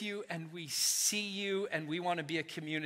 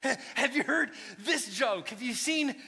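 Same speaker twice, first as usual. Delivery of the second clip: higher, bright and eager; a kid showing off; lightly voiced excited kid; eager child; 7 semitones higher, 3 dB louder than usual.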